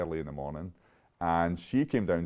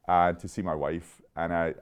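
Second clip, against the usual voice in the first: normal talking voice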